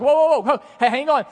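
high, loud call to stop him. Acted. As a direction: shout after someone walking away. Joseph calling after the cupbearer.

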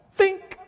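a short clipped imitated bat hit; imitated bat-on-ball sound; bat crack. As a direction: pop the word out like a bat crack. Kid's bat hitting the ball.

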